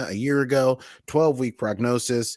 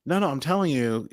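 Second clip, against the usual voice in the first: ordinary talk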